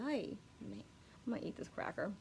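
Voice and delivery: usual speaking voice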